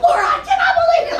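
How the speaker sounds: high, fast, loud scolding mother; high, fast mock-outraged mom. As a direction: squeal it like a shocked mom. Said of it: "my mom was like".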